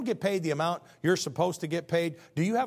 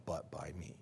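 soft whispered close to the verse; whispered scripture; 11 semitones lower, 13 dB quieter than usual. Acted. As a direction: breathe the last words out. Scripture quote ends in whisper.

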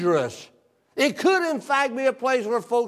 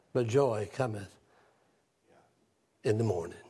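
solemn preaching; 11 semitones lower, 9 dB quieter than usual. Acted. Preacher's closing line.

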